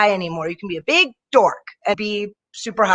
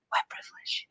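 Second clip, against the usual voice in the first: a knowing whisper; hushed mock verdict; 16 dB quieter than usual. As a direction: whisper it like a secret. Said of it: "I'm like".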